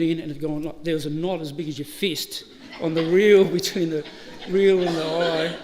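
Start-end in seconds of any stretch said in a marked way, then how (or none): 4.5-5.6 words squeezed out through laughter; slow, laughing it out; cracking up mid-sentence. Real, not acted. Laughing while describing the knot.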